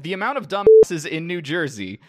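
mock sneering at dumbasses; quoting a fed-up tweet with gusto; mock exasperated. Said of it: "wrote a Mythbuster on Twitter".

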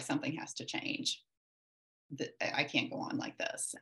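soft, breathy vow to herself; nearly whispered, worn out and decided; hushed resolve. Retold or imitated. Mom to herself at home, in a hushed, breathy vow. Retelling despair, deciding to change.

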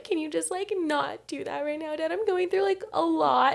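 high, wailing plea to dad; imitating bawling plea. Crying daughter begging her yelling dad to stop, high wail. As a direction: whine it like a teen mid-breakdown. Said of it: "I'm, like, fucking bawling".

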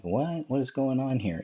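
lightly voiced wonder, not scared; intrigued. Host voicing woman on video, mild intrigued curiosity. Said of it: "She's almost intrigued".

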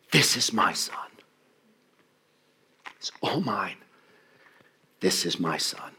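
near-whispered, with long pauses; hushed tender declaration. Acted. Preacher voicing God claiming His son, hushed. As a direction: let it sink in softly.

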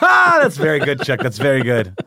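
loud, high burst through laughter; delighted, laughing out the words; laughing approval. Real, not acted. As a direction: crack up while praising the joke. Cracking up at co-host's joke.

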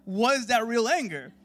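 high, very loud confession; full-force preaching. Real, not acted. Preacher confessing his hidden anger, full-force preaching. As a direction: pour it out loud and high-pitched.

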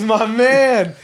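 loud, high buddy greeting; mock drunk holler. Drunk traveler greets a man he spotted, loud mock holler. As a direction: hail him like a drunk best pal. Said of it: "He goes".

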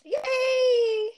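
high, loud squealed yay; excited cheer. Pretend. Co-host greeting the next topic, squealed cheer.

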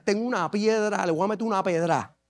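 low, soft confident boast; David tells Saul about his stone, quietly and low; cocky young David. Acted. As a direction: promise it quietly and low, sure of himself. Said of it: "¿Y con qué lo vas a derrotar?".

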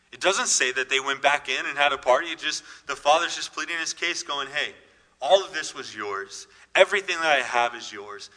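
loud, impassioned pleading preacher; rising preaching fervor. Real, not acted. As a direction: build it up and belt out the father's plea. Preacher building to the father's plea.

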